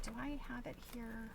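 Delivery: normal talking voice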